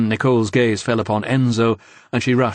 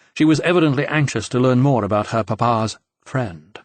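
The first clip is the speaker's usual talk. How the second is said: ordinary delivery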